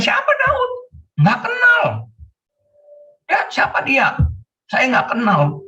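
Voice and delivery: high-pitched scornful brush-off; dismissive Nabal. Acted. Preacher playing a rich man refusing David's messengers, scornful and high. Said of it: "Nabal di sini bilang".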